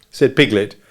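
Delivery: usual speaking voice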